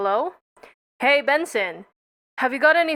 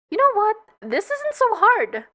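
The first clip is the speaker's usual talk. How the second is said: perky; 9 semitones higher than usual. Acted. Cheerful sudden confidence.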